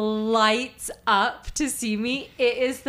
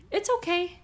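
higher, gentle imitation of a forgiving kid; reassuring child; 4 semitones higher, faster than usual. Child forgiving mom after her apology, reassuring. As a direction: lightly voice a little one comforting a parent.

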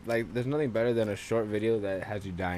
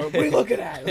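loud, high mock confrontation laughing; mock angry yell; 6 semitones higher, 6 dB louder than usual. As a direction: snap at it like a tough guy, laughing. Yelling at a candy wrapper.

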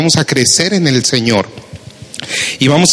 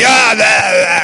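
louder, higher troll-like roar; 7 semitones higher, 4 dB louder than usual. Retold or imitated. Preacher mimics a raging husband as a troll, roaring. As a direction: bellow like an angry troll. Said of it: "así como troll".

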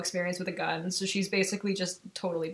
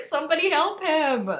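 retold distress; 8 semitones higher, 4 dB louder than usual. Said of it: "And I was like".

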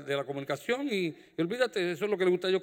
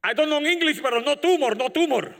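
excited patient; 8 semitones higher, 5 dB louder than usual. Retold or imitated. Patient retells in broken English.

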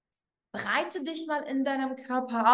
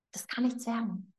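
whisper; 3 semitones lower, 7 dB quieter than usual. Acted. Coach voicing hopeless doubt.

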